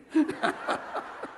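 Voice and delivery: breathy helpless giggle; high airy giggle; real giggle. Real, not acted. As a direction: let a real laugh slip out. Cracks up at the letter.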